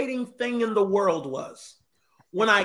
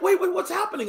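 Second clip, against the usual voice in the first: lost puppy; 10 semitones higher than usual. Retold or imitated. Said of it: "Like, lost puppy".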